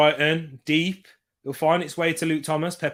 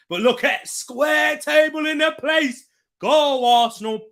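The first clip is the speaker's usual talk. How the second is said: excited cheer; 11 semitones higher, 5 dB louder than usual. Real, not acted. Cheering Arsenal passing.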